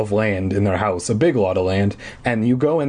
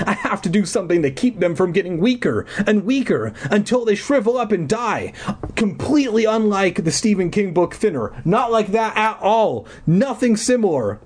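lightly voiced panicked girl; 9 semitones higher than usual. High panicked Sam voice.

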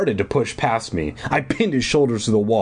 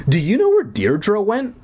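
higher-pitched, pressing question; demanding question; 11 semitones higher than usual. A narrator's reading. Camper asks counselor where her roommate went, demanding. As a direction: press for an answer, voice lifted. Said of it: "I demanded".

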